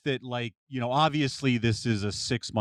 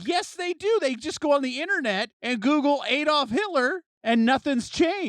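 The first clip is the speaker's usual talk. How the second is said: insistent excited; 13 semitones higher than usual. Real, not acted. Heated debate, insisting loudly.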